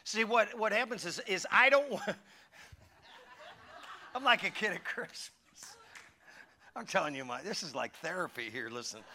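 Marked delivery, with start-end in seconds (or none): 4.2-5.3 said through a laugh. Real, not acted. Preacher laughing at his own early-morning story.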